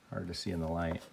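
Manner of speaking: ordinary delivery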